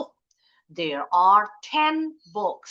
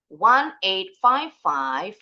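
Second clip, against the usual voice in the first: slow "One eight five five", lifted on the last "five"; rising intonation; 5 dB louder, much slower than usual. Shown as a demonstration. Teacher breaking up a phone number for students, rising intonation. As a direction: say "One eight five five" slowly, ending the group upward. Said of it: "intonasinya harus naik".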